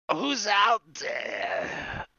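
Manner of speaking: loud, drawn-out giant's shout; giant calling out. Acted. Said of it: "you hear a voice. It's in, it's in Giant".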